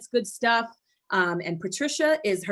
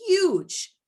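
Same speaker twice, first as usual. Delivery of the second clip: excited; 10 semitones higher, 4 dB louder than usual. Real, not acted. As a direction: burst out much higher, a bit louder. Stressing importance of accountability partner.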